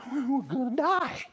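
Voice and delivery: high, panicked disciple; afraid. Acted. Disciple answers Jesus in the storm, afraid. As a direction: blurt it in mock terror. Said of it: "that's why we're afraid".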